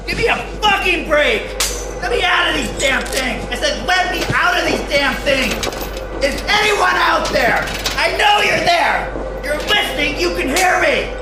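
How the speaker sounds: raging patient yelling to be released; enraged, desperate yelling; furious shouting. Acted. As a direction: scream at your captors to let you go. Restrained patient yells at staff.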